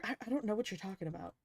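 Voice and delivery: soft, fast playing dumb; a quick murmured dodge; feigned innocence. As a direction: hurry past it, playing dumb.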